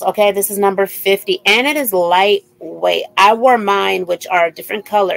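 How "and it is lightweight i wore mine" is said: usual speaking voice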